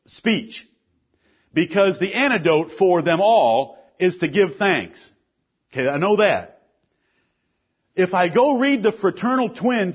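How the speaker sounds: emphatic, projected sermon delivery; forceful preaching. Real, not acted. Pastor warning the church about sin, forceful preaching. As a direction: preach it firmly, landing key words.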